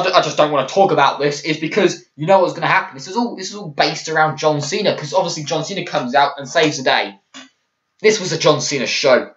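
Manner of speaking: normal talking voice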